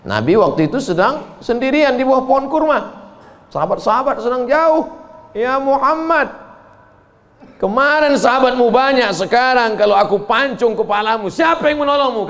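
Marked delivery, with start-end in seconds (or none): 5.3-12.3 hammed-up threat. Enemy threatens the Prophet.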